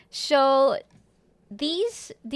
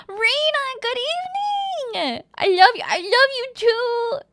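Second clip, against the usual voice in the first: gushing greeting; 12 semitones higher, 5 dB louder than usual. Real, not acted. Streamer greets a beloved chatter, gushing. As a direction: greet with a loud, adoring squeal.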